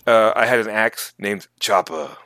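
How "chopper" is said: put-on lightly Austrian-accented axe name; name it with an action-star accent; Arnold Schwarzenegger accent. Telling co-host about his axe, Schwarzenegger-accented name. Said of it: "Arnold Schwarzenegger".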